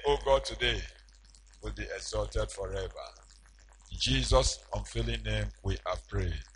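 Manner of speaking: low, soft closing prayer. Acted. Preacher closing prayer to congregation, softly. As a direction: pray it quietly over the people.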